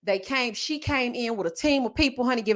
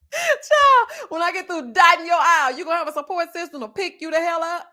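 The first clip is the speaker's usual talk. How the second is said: sassy comeback; 6 semitones higher, 5 dB louder than usual. Acted. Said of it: "indignant retort to nurse".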